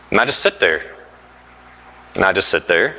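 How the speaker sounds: low, quiet, defeated deadpan; resigned. Retold or imitated. Preacher retelling giving up in a locked closet, low resigned hush. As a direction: drop right down, as if given up.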